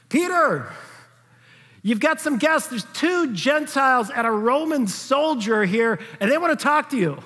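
high-pitched call to someone upstairs. Acted. Messenger calls up to Peter.

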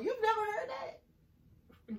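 high, slow, drawn-out disbelief; shocked disbelief. Real, not acted. Teasing her friend over a southern saying, shocked. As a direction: stretch it out in mock shock.

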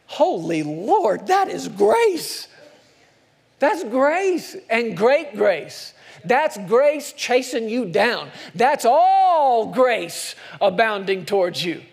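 slow, ringing and loud; preaching at full force. Real, not acted. Preacher to congregation, exultant and loud. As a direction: proclaim grace with all your breath.

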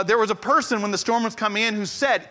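normal talking voice

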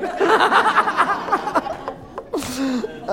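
laughing hard at his own joke; a preacher cracking up at his own punchline; high burst of laughter. Real, not acted.

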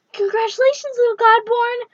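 high, loud and beaming; smiling congratulations. Acted. Goddess greets the surprised boy, high and beaming. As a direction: congratulate him like a proud, mocking goddess. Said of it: "Ixtab said, smiling".

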